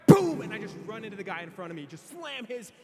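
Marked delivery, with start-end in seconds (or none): 0.1-0.4 explosive, very loud boom; sudden high burst of impact; crash noise. Retold or imitated. Imitates the head collision.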